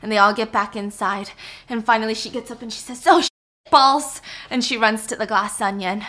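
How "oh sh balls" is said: louder, stinging curse, cut off; mock pained yelp. Helen with hot sauce stinging her face, cursing as she jumps up. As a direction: curse through the burning. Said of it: "but it's burning".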